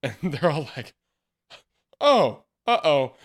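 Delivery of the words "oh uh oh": loud, drawn-out comic uh-oh; slow, raised-pitch mock alarm; mock dismay. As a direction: play a crowd suddenly caught out. Acting the crowd caught out.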